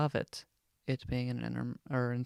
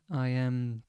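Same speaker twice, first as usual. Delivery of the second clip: ordinary talk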